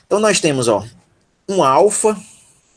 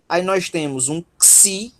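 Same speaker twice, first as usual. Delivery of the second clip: ordinary talk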